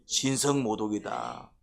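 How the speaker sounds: 신성 모독이다, drawn out very slowly and low; slow mocking accusation. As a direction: spell out the Pharisees' verdict with scorn. 바리새인의 판단 재연. (느리고 낮게 비꼬는 고발)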